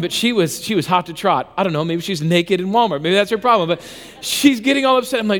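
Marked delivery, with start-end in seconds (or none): none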